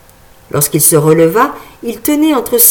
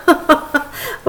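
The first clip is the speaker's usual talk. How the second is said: mocking laugh; 7 semitones higher, 3 dB quieter than usual. Boys burst out laughing.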